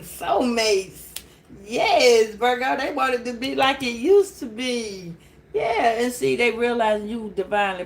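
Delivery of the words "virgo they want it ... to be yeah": ordinary delivery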